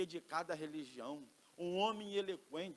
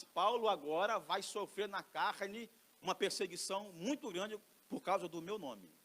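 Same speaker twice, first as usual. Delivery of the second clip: normal talking voice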